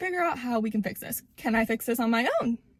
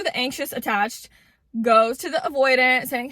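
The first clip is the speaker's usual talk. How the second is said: ordinary delivery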